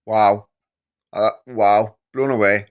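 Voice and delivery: loud, stunned wow; blown away. Real, not acted. Reacting to the clip, loudly amazed and stunned. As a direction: let the wow burst out, amazed. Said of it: "Absolutely blown away".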